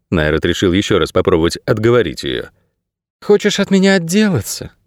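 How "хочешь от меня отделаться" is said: put-on high girl's voice, a touch weary; tiredly ask, pitched up; understated fatigue in a high voice. A narrator's reading. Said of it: "устало спросила Кейса".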